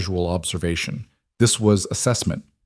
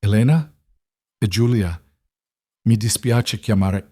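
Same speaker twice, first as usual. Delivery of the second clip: a lightly voiced nurse, strained and apologetic; strained, urgent nurse. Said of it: "The nurse's voice was strained, apologetic, urgent".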